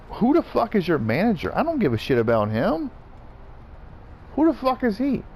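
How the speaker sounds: high, incredulous challenge; indignant, pitch leaping on the questions; scornful retort. Retold or imitated. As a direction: re-enact a dismissive comeback. Acts out scornful retort about manager.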